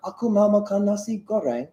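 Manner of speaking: high-pitched mock British accent on Indonesian. Shown as a demonstration. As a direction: say the Indonesian as a Brit would. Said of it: "tapi aksennya Inggris".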